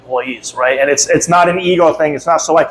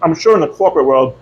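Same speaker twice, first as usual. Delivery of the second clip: ordinary talk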